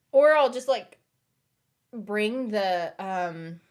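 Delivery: talking through hiccups; speech broken by hiccups. Real, not acted. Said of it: "the hiccups are bad today".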